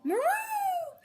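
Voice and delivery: a very high, squeaky cat merow; cat hiss. Acted. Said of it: "who hissed".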